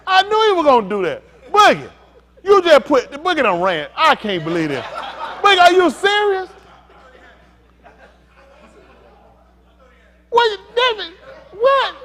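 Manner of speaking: loud, high-pitched playful outrage; mock disbelief. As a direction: shout as if you can't believe it. Feigned disbelief at game players.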